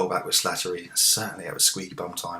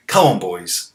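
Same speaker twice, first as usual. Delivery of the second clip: excited; 12 dB louder, slower than usual. Real, not acted. Urging team.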